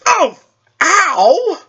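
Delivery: sudden high yelp of pain; high startled ouch. Acted. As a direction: yelp out, caught off guard. Sudden cry from back door.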